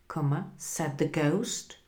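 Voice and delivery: plain reading voice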